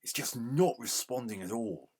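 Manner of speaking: usual speaking voice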